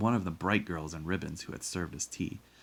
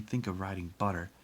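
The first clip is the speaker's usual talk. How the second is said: usual speaking voice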